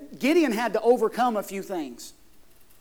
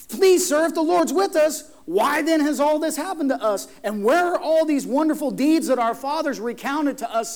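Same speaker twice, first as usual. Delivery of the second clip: raised, insistent challenge, high and loud; plain-spoken protest; 5 semitones higher, 5 dB louder than usual. Acted. Gideon questioning the angel about their troubles, plain-spoken protest. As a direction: press the complaint forcefully, pitched up. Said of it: "He says that very plainly".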